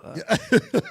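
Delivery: laughing. Real, not acted. Laughing after a joke.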